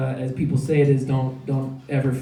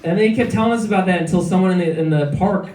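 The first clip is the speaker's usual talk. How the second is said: usual speaking voice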